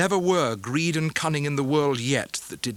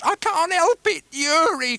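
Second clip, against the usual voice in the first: put-on much higher, louder pleading old woman; crying mother; 6 dB louder, slower than usual. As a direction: cry out to her son, begging. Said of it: "cried his mother".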